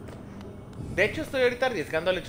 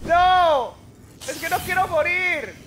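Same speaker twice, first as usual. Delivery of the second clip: frantic shouting; 12 semitones higher than usual. Real, not acted. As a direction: yell in panic at the screen. Dying in the game.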